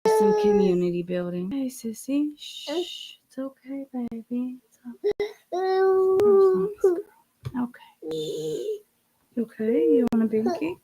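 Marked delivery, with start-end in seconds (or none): 1.5-10.8 quiet it's-okay to a little one. Real, not acted. Mother hushing crying baby.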